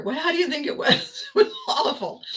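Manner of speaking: laughing. Real, not acted. Laughing while answering.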